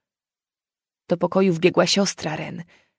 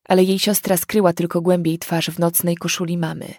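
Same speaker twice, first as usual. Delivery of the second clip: usual speaking voice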